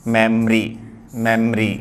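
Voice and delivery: मेमरी squeezed to two syllables, 'mem-ree'; correct pronunciation. Shown as a demonstration. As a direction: say मेमरी without any middle vowel. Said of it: "लेकिन होता सही क्या है?". (मेमरी को 'मेम-री' बोलें)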